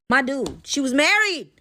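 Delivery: exasperated scoff. Real, not acted. Teller mocking Kier's delusion, exasperated. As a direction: scoff at him, fed up.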